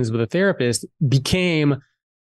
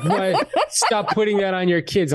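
lightly imitated indignant dad, pitched higher; objecting dad; 6 semitones higher, slower than usual. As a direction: mimic Dad's incredulous objection. Dad objects in retold argument.

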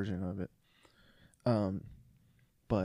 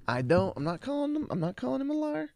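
much higher, fast mock innocence; sarcastic; 14 semitones higher, faster than usual. Real, not acted. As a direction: deny it with a sly rising sarcasm. Sarcastic denial of calling liar.

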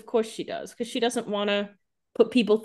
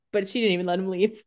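normal talking voice

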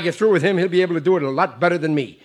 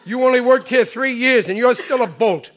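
proud boasting; 5 semitones higher than usual. Acted. Riley boasts about his club.

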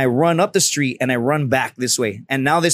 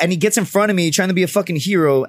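ordinary talk, nothing marked

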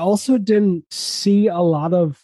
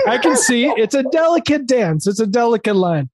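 high, gruff sitcom-dad voice, raised well above normal; Tim Allen impression; 8 semitones higher than usual. Hosting a sitcom, doing the Tim Allen voice. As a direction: ham up the Home Improvement guy. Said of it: "I was going Home Improvement".

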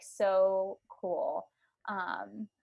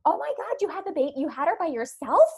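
mom's amazement; 7 semitones higher, 5 dB louder than usual. Retold or imitated. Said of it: "my mom literally was like".

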